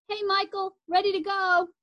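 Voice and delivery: high, bright call from a car. A narrator's reading. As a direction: call out cheerfully. Girl calls from car window.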